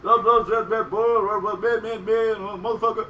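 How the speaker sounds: loud, high mimicked gibberish; mock unintelligible speech. Comic imitating speech he cannot understand, loud mock gibberish. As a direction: garble it into loud repeated syllables.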